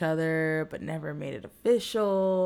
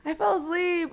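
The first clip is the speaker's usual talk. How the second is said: much higher, louder mock-embarrassed squeal; 13 semitones higher, 3 dB louder than usual. Woman realizes she fell asleep on a date, embarrassed. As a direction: replay your mortification in a high voice. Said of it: "I was embarrassed".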